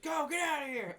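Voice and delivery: loud, high-pitched imitation of a yell; mock shouted order. Co-host acts out Stubbs facing Jason, mock shout. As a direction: yell it high, playing the part.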